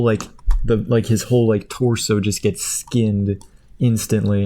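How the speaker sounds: normal talking voice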